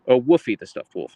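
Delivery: ordinary talk, nothing marked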